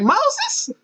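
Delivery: put-on very high-pitched questioning old lady; put-on high older woman's voice, rising; older neighbor woman. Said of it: "She's a little older".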